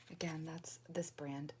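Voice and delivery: slow soft whisper; quiet at night. Real, not acted. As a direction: whisper slowly, hushed. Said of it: "I'm talking quietly".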